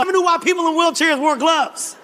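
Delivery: ordinary talk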